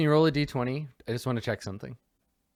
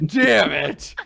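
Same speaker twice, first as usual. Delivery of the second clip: high, raised groan of frustration, laughing; mock dismay; 6 semitones higher, 3 dB louder, slower than usual. Suitor who kissed a mirror image, mock exasperation. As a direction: play the embarrassed suitor caught out. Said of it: "That's so embarrassing".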